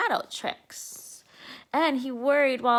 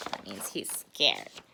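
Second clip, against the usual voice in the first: explaining aside; 8 dB quieter than usual. Real, not acted. Reader explains to child.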